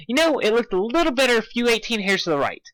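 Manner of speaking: high, eager pony voice; bubbly Pinkie. Acted. Pinkie directing Dash on the cloud, high and eager. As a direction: chirp it like a giddy party pony.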